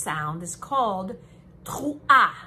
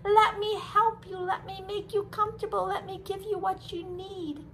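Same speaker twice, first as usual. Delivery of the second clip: higher, caring, reassuring voice; loving parent; 9 semitones higher than usual. Acted. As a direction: comfort a child tenderly. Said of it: "Hashem comes running and says".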